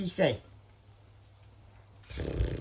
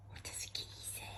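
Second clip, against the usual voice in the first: soft secret whisper; 21 dB quieter, faster than usual. Acted. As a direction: breathe the question in a whisper. Whispered retake to the dog.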